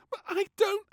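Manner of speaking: very high, loud, slow wail; a loud high child's wail. A narrator's reading. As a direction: wail in protest. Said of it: "wailed little Princess".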